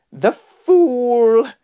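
high, loud, drawn-out witch's cry; wild witch's shriek. A narrator's reading. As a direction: shriek it in triumph, high and long. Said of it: "she cried".

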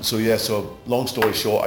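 usual speaking voice

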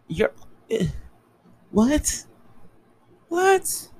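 squeaky high disbelieving what; incredulous what. Real, not acted. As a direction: shoot up into a shocked falsetto. Reacting in disbelief to homophobia.

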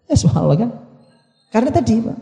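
usual speaking voice

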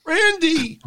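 squeaky high cry of the name; hammed-up high-pitched call; mock referee yelp. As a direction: yelp it way up high like a clueless ref. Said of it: "he looks at Randy and goes".

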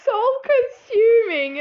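high, loud drawn-out mimicry; mocking. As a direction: echo it back mockingly. Mocking brother's phrase.

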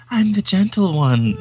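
put-on high, drawn-out effeminate old man; effeminate cat-like man. Imitating the film's strange old cat man, high effeminate croon. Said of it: "most disturbingly effeminate, cat-like old Chinese man".

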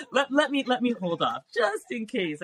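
high-pitched, giggly backing off; laughing. Real, not acted. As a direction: stammer it out through real laughter. Giggling over revealing new title.

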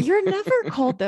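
mock concerned partner. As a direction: play the fussing partner. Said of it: "My concerned partner".

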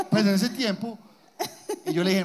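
ordinary delivery

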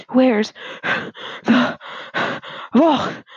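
panting between single words; huffing, out of breath. A narrator's reading. Father runs up with supplies, huffing for breath. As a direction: gasp out one word at a time. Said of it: "huffed Father".

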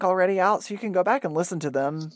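ordinary delivery

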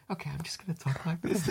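soft, fast casual aside; offhand actor voice. Retold or imitated. Actor off camera, soft quick casual talk. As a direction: toss it off quietly between takes. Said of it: "kinda going".

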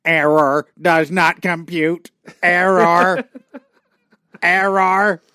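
high, loud, slow robot glitch; Dalek robot voice. Acted. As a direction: stutter it like a malfunctioning robot. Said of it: "turning into Daleks all of a sudden".

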